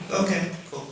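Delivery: high, falsely casual "okay, cool"; a scared kid faking calm in a high voice; put-on brave kid. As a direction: act unbothered though scared. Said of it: "try to be a strong kid".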